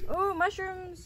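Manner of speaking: high, slow delighted gasp; excited. Real, not acted. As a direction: delight at a find. Spotting mushrooms.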